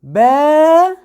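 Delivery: با drawn out high and loud; long vowel. Shown as a demonstration. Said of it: "دي اللي ممدودة". (با بمدّ طويل عالي)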